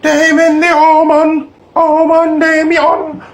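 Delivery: high, loud, slow taunting voice; sing-song tempting voice calling out; mystery voice. Retold or imitated. Voice in his head tempting him to eat cake. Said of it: "I heard a voice and it went".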